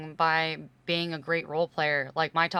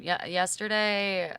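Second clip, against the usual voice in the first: ordinary delivery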